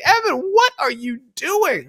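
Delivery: high, drawn-out mock astonishment; incredulous. Hotel staff seeing Evan leave a trash truck, imitated disbelief. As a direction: stretch it out in scandalised disbelief. Onlookers' shocked question acted out.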